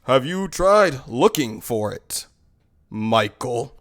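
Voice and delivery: slow, low, deadpan question; measured authority talking someone down; composed manager. Retold or imitated. Manager calmly tells knife man.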